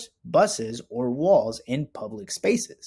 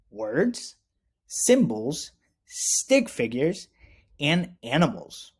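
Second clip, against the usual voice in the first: slow, drawn-out list of items; slower than usual. Real, not acted. Teacher lists what the art shows, slowly.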